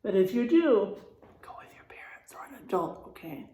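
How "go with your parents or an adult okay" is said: confiding whisper. Real, not acted. Teacher advising the class, soft confiding whisper. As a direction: whisper it like a friendly secret. Safety aside to children.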